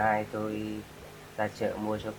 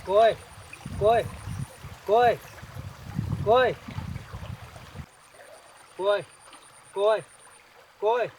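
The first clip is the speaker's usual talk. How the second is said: loud, high calls of alarm; repeated urgent high calls; calling out; 9 semitones higher, 5 dB louder, faster than usual. Acted. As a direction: shout to rouse someone lying still. Calling out to unconscious woman.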